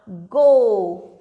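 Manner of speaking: गो loud and low, "oh" held; teacher's model गो with a stretched "oh"; long O sound. Shown as a demonstration. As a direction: draw out गो as "gohh". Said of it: "ओ का sound आएगा".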